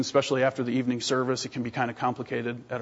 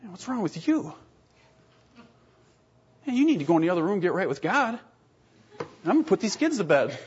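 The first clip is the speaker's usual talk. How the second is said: pious brush-off; 6 semitones higher than usual. Retold or imitated. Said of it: "I'm like".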